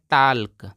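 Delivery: "टाल्क" with the L sounded and long aa; wrong pronunciation. Shown as a demonstration. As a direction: mispronounce it as "टाल्क", L audible. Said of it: "काफ़ी सारे लोग पढ़ते हैं".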